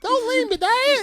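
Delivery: put-on high pleading kid voice; whiny child voice. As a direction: beg like an abandoned kid. Joking as abandoned child.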